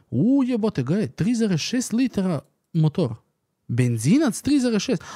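high, loud, thrilled exclamation; amazed excitement. Real, not acted. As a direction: wow it out in disbelief. Amazed by engine specs.

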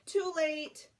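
loud, high exclaim; dramatic. A narrator's reading. Reader announcing the disaster with a loud exclaim.

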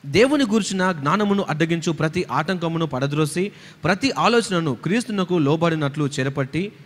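slow, low, soft recitation of scripture; scripture reading. Real, not acted. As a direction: read the verse aloud, measured and quiet. Interpreter reads the verse.

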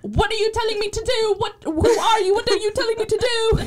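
high-pitched mock indignation; lightly voiced indignant girl; imitating a shrill outburst. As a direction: squeak it out, playfully offended. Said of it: "she has, like, a Scottish accent".

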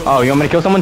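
normal talking voice

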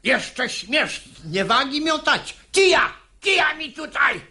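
furious father yelling for a stick; furious outburst. Acted. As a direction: explode with rage. Father in rage at son.

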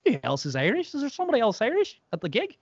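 retold puzzled questions climbing high; mock bewilderment. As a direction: act out baffled disbelief, pitch rising sharply. Retelling his bewilderment at the gig.

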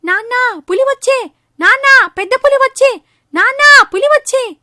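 high, loud cry for dad; boy screaming for his father from the tree; shouting. Acted. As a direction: yell out a fake tiger alarm. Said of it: "అని అరవడం మొదలు పెడతాడు".

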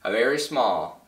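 plain reading voice

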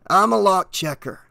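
a lightly voiced sly stranger; slick, friendly stranger; stranger's voice. A narrator's reading. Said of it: "the stranger said".

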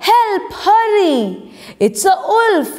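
high, contained cry for help; mild storybook shout. A narrator's reading. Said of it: "Chandu shouted".

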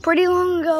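a big, proud call of the phrase; loud catchphrase. Shown as a demonstration. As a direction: shout the catchphrase out like a slogan. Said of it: "so I said it, like, a thousand times".

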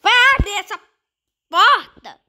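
high, loud command to open the door; loud urging. Real, not acted. Gamer yells at the game to open the door, loud.

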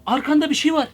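urgent, high-pitched call; alarmed warning. Real, not acted. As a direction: warn a friend insistently. Alarmed warning to friend on video.

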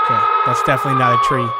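fast, very high panicked squeal; startled shriek. Real, not acted. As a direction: jump out of your skin. Startled by monster scream.

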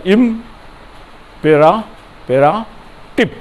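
ইম- পেরা- পেরাটিভ broken into syllables, the last part slow. Shown as a demonstration. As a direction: split ইম-, পেরা-, পেরাটিভ into separate beats for students. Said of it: "syllable ভাগ করে করে উচ্চারণ করো".

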